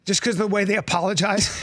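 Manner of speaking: ordinary talk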